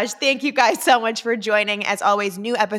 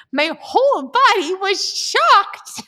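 mock dramatic; 10 semitones higher, 5 dB louder, slower than usual. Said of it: "This was dramatic".

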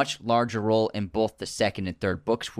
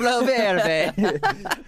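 put-on Italian accent; 10 semitones higher, 3 dB louder than usual. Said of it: "I'm Italian brain right now".

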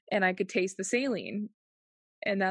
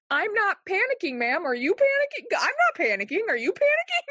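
much higher, louder panicked retort; mock panic; 11 semitones higher, 5 dB louder than usual. Patient retorting to nurse who said don't panic, mock panic. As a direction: insist you're calm in a high mock-panicked voice.

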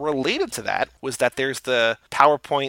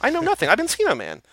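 mock realization; 13 semitones higher, 4 dB louder, faster than usual. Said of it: "Like, she's like".

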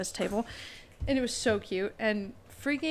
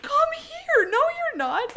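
very high, louder happy squeal; squealing excitement; 7 dB louder, slower than usual. Retold or imitated. Greeting Derek who is suddenly over six feet, squealing. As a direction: gush over him in delighted disbelief. Hugging the grown cousin.

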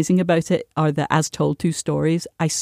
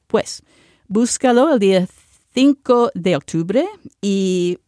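speaking Spanish. Real, not acted. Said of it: "I just wanna speak in Spanish".

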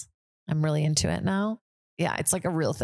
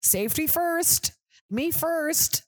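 mocking, much higher and slow; callous driver mockery; 10 semitones higher, much slower than usual. Mocking drivers who hit animals, much higher, slow and flippant. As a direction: sneer it like a careless driver. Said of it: "be like".

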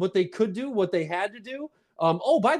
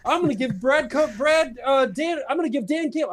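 usual speaking voice

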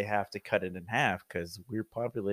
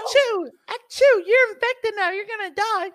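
put-on much higher, gleeful little kid; shrill mock sneeze and taunt; high child voice; 7 dB louder than usual. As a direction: tease like a kid on the playground. Kids playing sneeze game.

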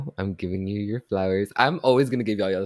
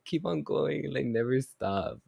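put-on imagined inner child; 5 semitones higher than usual. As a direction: cheer in a small child's voice, no volume. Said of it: "my inner child screaming for me".

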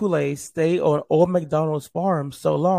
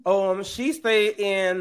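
usual speaking voice

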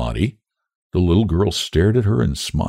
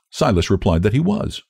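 ordinary delivery

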